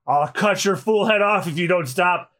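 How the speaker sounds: ordinary delivery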